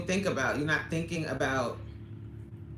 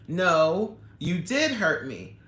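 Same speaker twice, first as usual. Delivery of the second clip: ordinary talk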